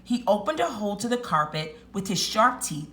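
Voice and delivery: animated storytelling with lilting pitch and stressed key words; lively picture-book narration; story read-aloud. A narrator's reading. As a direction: read it to young children, savoring each big moment. Reads story to children.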